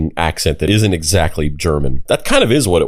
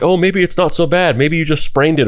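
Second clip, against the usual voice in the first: higher-pitched hopeful reassurance, imitated; 8 semitones higher than usual. Strangers consoling someone with a hurt ankle, imitated hopefulness. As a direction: mimic hopeful onlookers, pitched up. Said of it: "they're kinda being hopeful".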